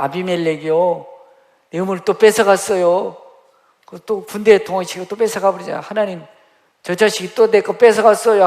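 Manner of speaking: high, loud pleading complaint; loud whiny plea to heaven about a stolen well; Abraham whining to God. Acted. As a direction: wail like a wronged man begging God.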